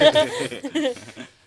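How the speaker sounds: loud, high laugh; burst of laughter. Real, not acted. Host cracking up at the city heckling, real loud laugh. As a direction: crack up loudly.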